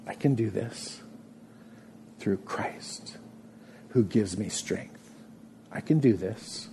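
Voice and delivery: soft, near-whispered, slow vow of faith; quiet resolve. Acted. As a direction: murmur it to yourself in trust. Quiet personal prayer of resolve.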